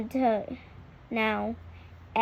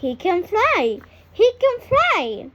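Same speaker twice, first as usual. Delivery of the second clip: high, loud, rising into a joyful shout; excited cry; 7 semitones higher, 6 dB louder than usual. A narrator's reading. Narrator cries out as the duckling flies, thrilled. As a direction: burst out in delight.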